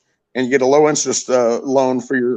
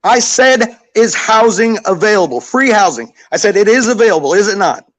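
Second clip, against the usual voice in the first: loud, high, emphatic self-defence; mad; 6 semitones higher, 4 dB louder than usual. Real, not acted. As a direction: hammer the point home angrily. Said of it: "I don't know why he got so mad".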